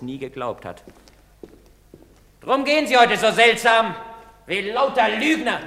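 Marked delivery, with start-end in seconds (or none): 2.4-5.6 high, loud scorn; bitter outburst. Acted. Andri rails bitterly against the townsfolk. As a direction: spit it at the town.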